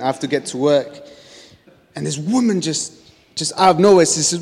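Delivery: ordinary delivery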